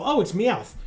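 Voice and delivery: imitated "aha, I know that one"; mock recognition. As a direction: re-enact calling out a familiar voice. Re-enacts recognizing a voice actor.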